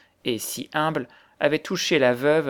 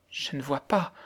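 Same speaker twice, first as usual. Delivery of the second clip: soft, timid murmur; frightened murmur; 6 dB quieter than usual. A narrator's reading. Terrified girl answering the angry widow, frightened murmur. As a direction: murmur it, cowed and quiet. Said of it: "murmura la pauvre enfant, terrifiée".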